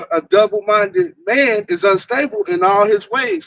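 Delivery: scripture reading. Acted. Reading James one and eight aloud to listeners, slow and solemn. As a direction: read the verse out slowly and gravely, like from the pulpit. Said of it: "and it reads".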